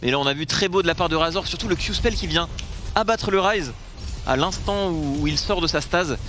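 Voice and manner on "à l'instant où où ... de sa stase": ordinary delivery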